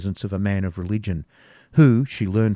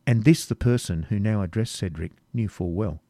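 ordinary delivery, nothing marked

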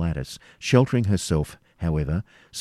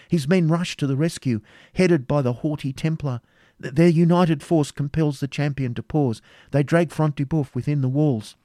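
raised-pitch eyewitness commentary; lightly voiced anxious woman reporting a fight; agitated Rebecca, urgent report; 9 semitones higher, 3 dB louder than usual. A narrator's reading.

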